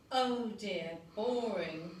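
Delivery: puffing, bored. A narrator's reading. Minty puffs at his egg sandwich, bored and weary. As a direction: puff it out like a bored kid. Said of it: "puffed Minty".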